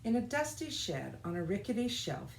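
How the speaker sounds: ordinary delivery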